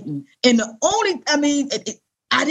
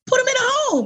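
much higher, breezy imitation of coworkers; mock coworker; 13 semitones higher than usual. Mocking coworkers who advise a nursing home, high breezy voice. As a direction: toss it off like it's obvious. Coworkers' casual advice mimicked.

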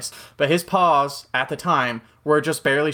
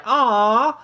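tender aww; 12 semitones higher, 5 dB louder than usual. Retold or imitated. Softhearted reaction to stray puppy.

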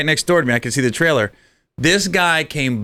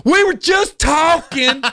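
high, slow indignant cry; protesting girlfriend; slower than usual. Retold or imitated. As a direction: plead innocence to the whole street.

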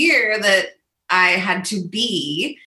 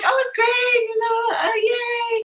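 high, forced chipper voice, masking sadness; bright fake smile over a breaking voice; fake cheerful; 13 semitones higher, slower than usual. Pretending to networking friends she's fine, forced cheer. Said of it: "put on a smile and".

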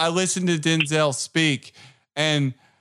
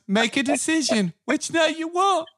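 Denzel voice; 10 semitones higher than usual. Retold or imitated. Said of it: "He goes".

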